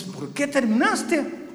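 high, drawn-out retold disbelief; incredulous question. Pastor asking a girl why she ended it, incredulous. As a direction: stretch it out high, demanding a reason.

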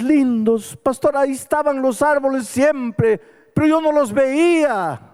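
high, bright amazement at the birds and trees; joyful wonder. Retold or imitated. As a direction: marvel as if seeing the world anew. Man recalls waking up joyful. (asombro alegre, agudo y entusiasta)

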